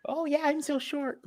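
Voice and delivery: high mocking falsetto; mock whiny girl. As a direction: sneer it in a high little voice. Mocking short women's complaint.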